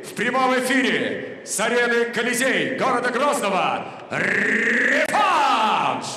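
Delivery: building to a long sung-out shout; ringmaster. Acted. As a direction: hype the crowd, stretch the last word. Announcer builds to the rematch call.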